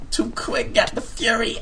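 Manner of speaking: high shouted rap line; hammed-up rapper shout. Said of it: "supposed to sound like a rapper".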